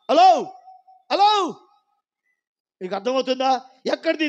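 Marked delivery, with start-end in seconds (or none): 0.1-1.5 calling out. Real, not acted. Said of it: "calling out to the congregation".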